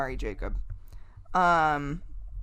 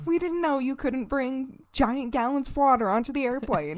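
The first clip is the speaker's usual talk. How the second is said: high-pitched naive Wisconsin family voice; Wisconsin accent; 8 semitones higher than usual. Retold or imitated. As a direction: play a clueless Wisconsin traveler, pitched high. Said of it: "a Wisconsin accent for some reason".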